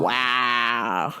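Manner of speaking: low, drawn-out WOW; low mock disbelief; appalled wow. Appalled reaction, retold.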